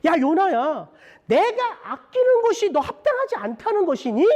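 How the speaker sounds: high-pitched, emphatic challenge; God confronting Jonah. Acted. God questioning Jonah about what He cherishes, high emphatic rebuke. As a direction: call him out with an urgent, high question. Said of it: "하나님이 요나에게 말씀하시네요".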